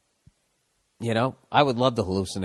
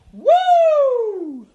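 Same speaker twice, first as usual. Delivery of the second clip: much higher whooping coach cheer; Pete Carroll whoop; 5 dB louder than usual. Retold or imitated. Mimicking a coach's whoop for a listener, high whoop. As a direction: let rip a coach's victory whoop. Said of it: "here's my Pete Carroll impression".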